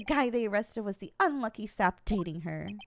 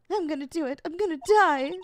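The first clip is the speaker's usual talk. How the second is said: despairing Larry voice; 6 semitones higher, 5 dB louder than usual. Acted.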